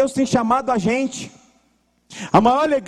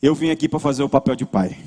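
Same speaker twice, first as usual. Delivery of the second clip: men volunteering as fathers; 9 semitones lower than usual. Retold or imitated. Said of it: "quatrocentos homens estavam lá e falando assim".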